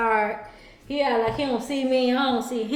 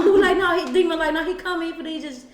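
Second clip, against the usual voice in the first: ordinary talk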